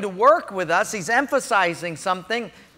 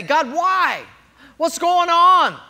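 loud, higher cry to God; crying out to God upset by a setback; 8 semitones higher, 6 dB louder, slower than usual. Retold or imitated. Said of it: "we get upset and say".

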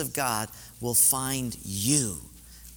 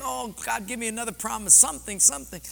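high-pitched, pleading prayer; desperate retold plea; 10 semitones higher, faster than usual. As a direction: beg God for help, voice lifted high. Retells desperate prayer while driving.

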